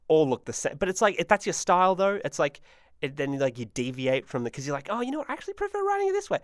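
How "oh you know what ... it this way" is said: light high-pitched musing voice, quick; lightly put-on higher voice for a thought; musing to oneself. Imagining someone deviating from their writing style, musing voice.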